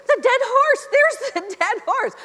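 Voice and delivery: high, loud cry of discovery; excited screaming. Retold or imitated. Wife showing husband the dead horse in the rocks, re-enacted excited scream. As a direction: re-enact a frantic, thrilled sighting. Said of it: "I started screaming".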